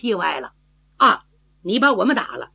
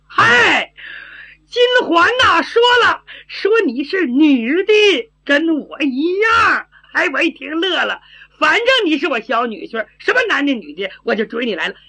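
put-on simple-minded girl, gleeful; 6 semitones higher than usual. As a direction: blurt it out high and artless, easing off toward the end. (傻丫头高声报喜)

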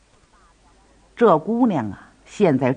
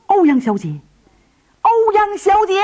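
calling out; 4 dB louder than usual. Acted. Heroes call out to dying girl.

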